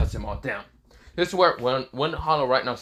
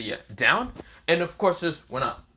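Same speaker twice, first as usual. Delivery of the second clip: plain reading voice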